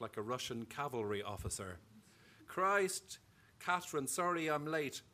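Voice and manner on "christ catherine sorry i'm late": put-on Galway accent, louder exclamation. A narrator's reading. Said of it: "he spoke with a Galway accent".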